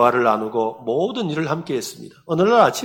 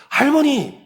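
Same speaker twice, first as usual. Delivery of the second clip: high, raised voice for poor hearing; acted pastor, high-pitched insistent question; shouting into an ear; 11 semitones higher, 3 dB louder than usual. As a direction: call it right into the old woman's ear. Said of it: "귀에다 대고".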